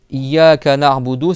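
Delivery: IYYAKA NA'BUDU loud and slow, na'budu joined; unbroken word. Shown as a demonstration. Teacher models the verse, na'budu as one word. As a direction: say Iyyaka na'budu without breaking na'budu. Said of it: "Ça, c'est un seul mot".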